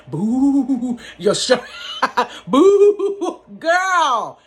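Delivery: high, loud squeal of fright and laughter; laughing high squeal at a spooky outfit. Retold or imitated. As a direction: shriek it out, half laughing, half scared. Child squealing at ghost outfit.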